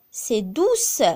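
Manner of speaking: ordinary delivery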